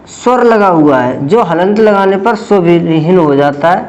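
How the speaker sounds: normal talking voice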